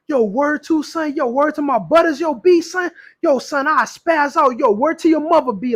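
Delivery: mock New York street talk; New York accent. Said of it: "my New York accent".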